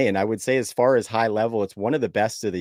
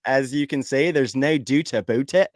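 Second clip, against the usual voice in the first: put-on joking, slightly raised Scottish-style accent; 3 semitones higher than usual. Canadian host jokes about his own nationality, in a Scottish accent. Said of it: "I guess that was a Scottish accent".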